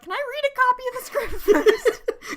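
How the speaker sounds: high, fast, loud, laughing through it; quick high mock Jem, giggling; imitating Jem. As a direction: blurt it like a sensible heroine. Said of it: "she's like".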